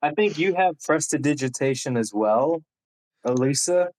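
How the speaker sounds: normal talking voice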